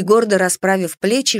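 usual speaking voice

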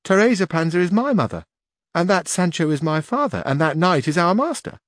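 put-on high, bright young village girl voice. A narrator's reading. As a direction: voice her as an eager young girl. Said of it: "a young girl who was washing stood up and said".

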